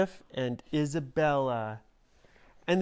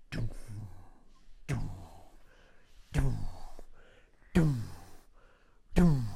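stomping doom sounds; 5 semitones lower than usual. Shown as a demonstration. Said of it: "like that".

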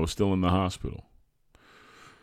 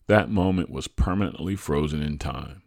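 ordinary delivery, nothing marked

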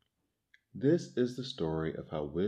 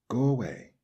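higher, louder storybook yell; read-aloud yell, higher and louder; 5 semitones higher, 5 dB louder than usual. A narrator's reading. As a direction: shoo him off with a shout. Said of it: "yelled Baboon".

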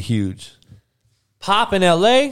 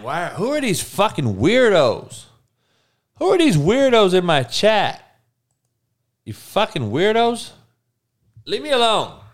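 high, irritated mock outrage at chat; exasperated; 8 semitones higher than usual. Real, not acted. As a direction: shoo them off, fed up. Scolding weirdos in chat.

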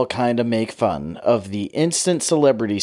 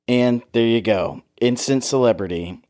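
ordinary delivery

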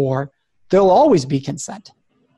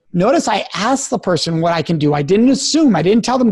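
usual speaking voice

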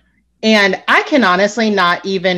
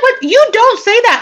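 very high, louder scolding; retold outrage; 14 semitones higher, 4 dB louder than usual. Speaker reacting to Ebony's remark, scandalized. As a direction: object in high-pitched disbelief.

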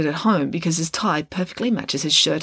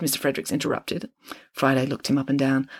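ordinary delivery, nothing marked

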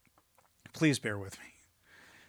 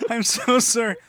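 usual speaking voice

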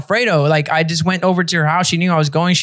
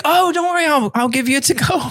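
put-on girlfriend's voice; 8 semitones higher than usual.

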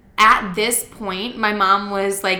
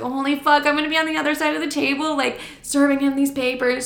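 higher, retold nervous dread; mildly panicked; 5 semitones higher than usual. Daughter dreading serving her father papers, mildly panicked. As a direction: think aloud in mock alarm.

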